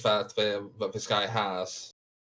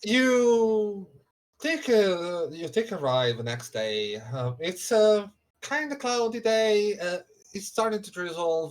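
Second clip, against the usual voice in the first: higher, slower scene setting; game master narration; 7 semitones higher, slower than usual. Acted. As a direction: paint the weather scene gently.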